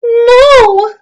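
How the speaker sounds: put-on high, loud pleading child cry; children's loud high protest. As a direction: wail no like a desperate kid. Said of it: "cried the children".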